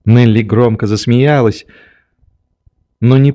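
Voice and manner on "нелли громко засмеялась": usual speaking voice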